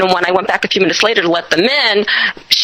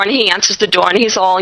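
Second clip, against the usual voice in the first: ordinary talk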